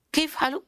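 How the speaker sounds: kaif halu said quick and high; casual Arabic speech. Shown as a demonstration. Teacher imitates casual spoken Arabic. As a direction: say it like everyday Arabic.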